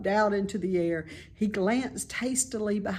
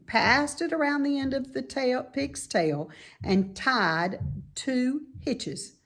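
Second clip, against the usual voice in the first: ordinary delivery, nothing marked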